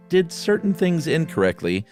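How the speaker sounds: ordinary talk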